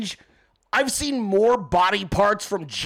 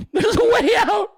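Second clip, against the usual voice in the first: much higher, louder yell breaking into laughs; mock shout cracking into laughter; 12 semitones higher, 5 dB louder than usual. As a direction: scream it again but lose it laughing. Trapped person yelling for escape.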